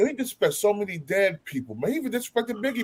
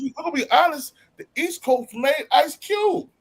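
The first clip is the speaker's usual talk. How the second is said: insistent; 7 semitones higher than usual. Real, not acted. Jumping in to argue about [name], insistent and higher. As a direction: cut in to correct him, raised pitch. Heated correction in debate.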